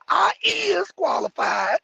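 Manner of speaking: high, slow, tearful mock; mock crying applicant. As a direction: whine it like a sobbing applicant. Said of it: "talking about".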